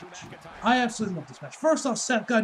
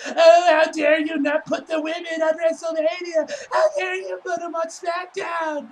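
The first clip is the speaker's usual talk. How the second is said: higher, louder whiny mock outrage; mocking pissy outrage; 9 semitones higher, 7 dB louder than usual. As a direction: sneer at them with a shrill crying whine. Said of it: "they would have gone all pissy and be like".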